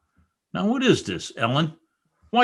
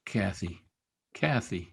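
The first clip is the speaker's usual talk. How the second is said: soft grieving; 7 semitones lower, 9 dB quieter than usual. Acted. Heathcliff grieving at her bed.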